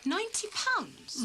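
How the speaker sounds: drawn out slowly, rising high in dismay; wife aghast at what her husband spent on plants; shocked disbelief. Acted. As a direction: let the sum sink in with horror.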